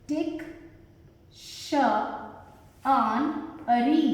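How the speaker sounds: "डिक्शनरी" extremely slow, with long gaps between sounds; syllable by syllable. Shown as a demonstration. Teacher introduces word to learners, "डिक्शनरी" very slowly segmented.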